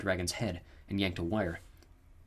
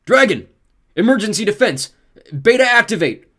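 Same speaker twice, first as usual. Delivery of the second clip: loud, high barked orders; full-voiced command shout; 12 semitones higher, 14 dB louder than usual. A narrator's reading. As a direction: shout across the clearing at the dragon.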